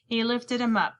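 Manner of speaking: usual speaking voice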